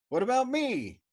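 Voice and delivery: high, loud sulky demand; petulant demand. A narrator's reading. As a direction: whine it like a slighted child. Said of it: "demanded petulantly".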